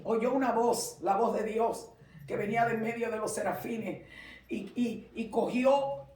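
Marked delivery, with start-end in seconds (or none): none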